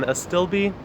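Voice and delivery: astilbe said as "uh-STILL-bee"; three syllables in astilbe, uh-STILL-bee; correct pronunciation. Shown as a demonstration. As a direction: say astilbe with a short i and a final "bee". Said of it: "It's not pronounced astilbe, it's astilbe".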